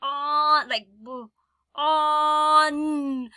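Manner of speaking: b- then a loud, drawn-out on; teacher segmenting b-awn; sounded-out word. Shown as a demonstration. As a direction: split the word and stress the ending. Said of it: "If it ends".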